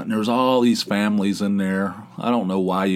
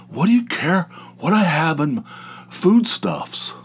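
higher, put-on voice for a touchy friend; mock defensive Gary; 8 semitones higher than usual. Gary snapping back about his food, mock high voice. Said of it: "So when he says".

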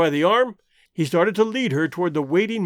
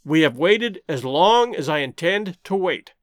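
ordinary delivery, nothing marked

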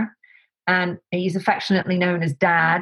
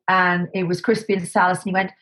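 usual speaking voice

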